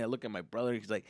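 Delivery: usual speaking voice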